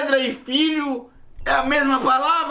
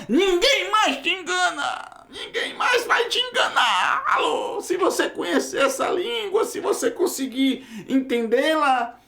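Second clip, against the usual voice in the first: much higher, cracking cry of conviction; fervent preaching shout; 8 semitones higher than usual. Real, not acted. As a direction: preach it at full force, almost crying out. Pregador exalta a língua hebraica. (pregação fervorosa, gritando alto e emocionado)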